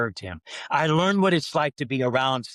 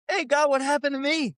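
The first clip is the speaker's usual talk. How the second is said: much higher, a mock whiny cry to God; exasperated plea; 13 semitones higher than usual. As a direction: toss it up to heaven like a hurt complaint. Complaining to God about failure.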